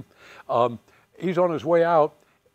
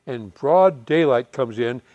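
usual speaking voice

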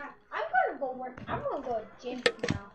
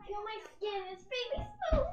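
much higher, playful squeal; high silly voice; 8 semitones higher than usual. Pretend. Showing off soft skin.

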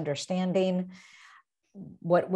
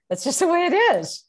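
laughing; 11 semitones higher, 7 dB louder, faster than usual. Real, not acted. Laughing punchline after quote.

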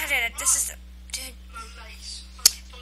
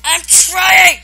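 frustrated yell; 9 dB louder, much slower than usual. Real, not acted. Player under attack, yelling back in frustration. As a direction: shout it out at the attackers.

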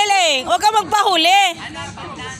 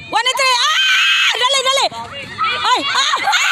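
calling out; 6 semitones higher than usual. Real, not acted. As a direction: shout the number out. Host calling numbers, shouting.

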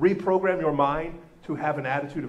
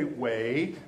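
rising, drawn-out warnings to a dog; coaxing wait; 3 semitones lower, much slower than usual. Retold or imitated. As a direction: hold the dog back, each wait lifting. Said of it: "You say".

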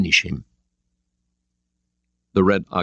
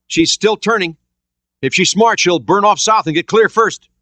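urgent sonar report; 9 semitones higher, 4 dB louder than usual. A narrator's reading. Jones tracks the Alpha.

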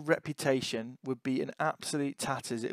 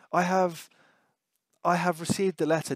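"I have" raised and left hanging, then repeated; halting "I have", higher and louder, a gap before "I have received"; pause after "I have"; 5 semitones higher, 5 dB louder than usual. A narrator's reading. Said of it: "Note that pause".

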